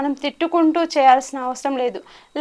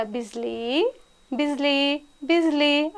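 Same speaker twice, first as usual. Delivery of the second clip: "Bijli" called much softer and tenderly, starting very quiet; lovingly calling; 5 dB quieter, slower than usual. Retold or imitated. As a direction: coax the horse gently by name. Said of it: "అని ప్రేమగా పిలిచాడంట". (బాబా "బిజ్లీ" అని మెత్తగా, ప్రేమగా పిలవడం)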